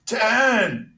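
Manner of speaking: a sudden loud, high shout of the number. Retold or imitated. Salesman in joke yells his joke number at the restaurant, loud and high. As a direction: blurt it out loud and proud. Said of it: "He stands up and goes".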